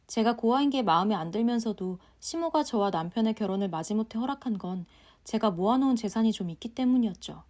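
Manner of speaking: usual speaking voice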